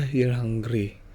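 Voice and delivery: ordinary talk, nothing marked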